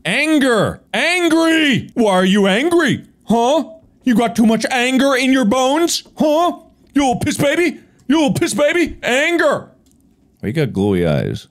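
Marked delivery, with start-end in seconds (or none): 0.0-0.8 taunting yell. Shown as a demonstration. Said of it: "I'm gonna go out and say angry". 0.9-9.7 mocking taunt. Taunting the ghost to provoke it.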